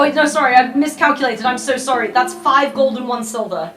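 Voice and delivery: ordinary talk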